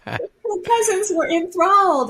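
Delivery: laughing. Real, not acted. Joyful retelling, laughing.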